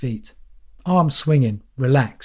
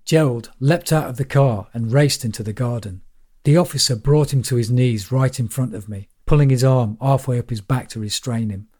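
usual speaking voice

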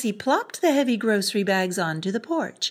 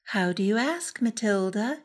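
ordinary delivery

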